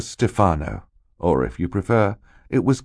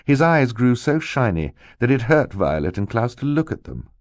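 usual speaking voice

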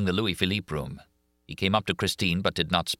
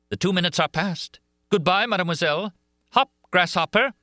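much higher, louder taunting farewell; mocking madman; 12 semitones higher, 5 dB louder than usual. A narrator's reading. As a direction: taunt her as a gleeful madman. Eric's final threat.

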